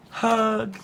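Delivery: high, soft, cooed word; soft, affectionate storybook payoff; tender hug. A narrator's reading. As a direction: coo it softly and high.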